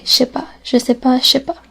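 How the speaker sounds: je sais pas rattled off quickly as shépa; fast. Shown as a demonstration. Teacher shows the fast spoken form. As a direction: rush it together. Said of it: "if you pronounce it fast".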